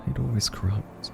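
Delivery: hushed and resigned; quiet resignation. Acted. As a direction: let it out as a soft, weary afterthought. Resigned aside after warning about power.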